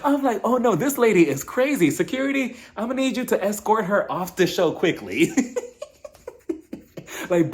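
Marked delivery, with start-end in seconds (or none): 0.4-5.3 high-pitched, comic panic tipping into laughter; mock alarm. Host calling security on a crazy lady, mock-alarmed. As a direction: pretend to summon security in a fluster.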